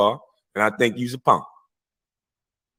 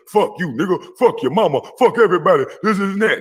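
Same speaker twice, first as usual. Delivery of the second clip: hammed-up angry outburst, voice up high; mock blasting rant; 7 semitones higher than usual.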